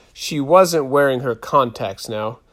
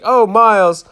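mock swooning; 9 semitones higher, 9 dB louder than usual.

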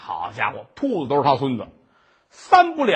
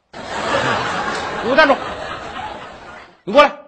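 high, loud commanding yell; the boy shouting the monk down; boy's angry shout; 8 semitones higher, 6 dB louder than usual. Acted. (孩子大声怒喝)

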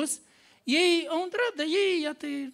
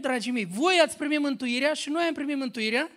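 raised, emphatic preaching voice; 5 semitones higher, 6 dB louder than usual. Acted. Preacher proclaiming grace to the church, emphatic and raised. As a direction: proclaim grace to the congregation with force.

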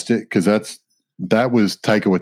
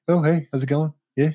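put-on New Zealand accent; 6 semitones higher than usual. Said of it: "the New Zealand accent is".

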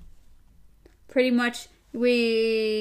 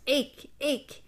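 harsh animal call; 4 semitones higher than usual. Acted. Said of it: "in his harsh voice".

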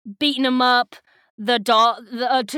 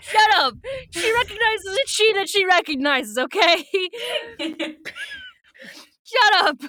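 laughing shut up; 9 semitones higher than usual. Real, not acted. Host cracking up at her own [name] joke, laughing.

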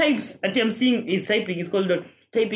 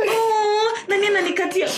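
high, breathless mock swoon; mock dainty swoon; 11 semitones higher than usual.

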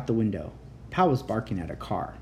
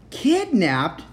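loud, high shocked cry; shocked yell; 9 semitones higher, 5 dB louder than usual. A narrator's reading. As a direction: blurt it out in alarm. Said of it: "the other three kids yelled".

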